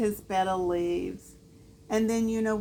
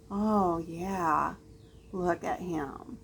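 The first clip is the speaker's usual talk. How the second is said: usual speaking voice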